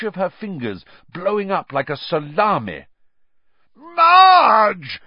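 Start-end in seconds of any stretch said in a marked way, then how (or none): 3.8-5.0 very high, loud panicked yell; yelled together. A narrator's reading. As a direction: shout her name in alarm. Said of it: "yelled Uncle Vernon and Aunt Petunia".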